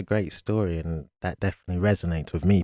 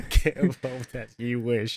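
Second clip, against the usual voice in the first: amused mock echo; 5 semitones higher, slower than usual. Host echoes guest's phrase, amused.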